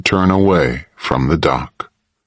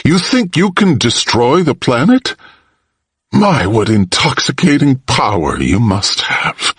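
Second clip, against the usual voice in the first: scornful fury; 8 semitones higher than usual. A narrator's reading. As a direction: sneer at his arrogance. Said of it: "Malcolm said in fury".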